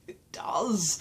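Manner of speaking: breathy whispered aside; whispering. Real, not acted. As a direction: whisper it like a secret. Whispered confession.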